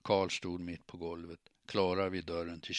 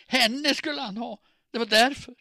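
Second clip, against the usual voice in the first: high, loud hysterical scream; 11 dB louder than usual. A narrator's reading. Jilted woman pointing at her rival, screaming. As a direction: scream the accusation at full pitch. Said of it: "pekade in mot köket och skrek".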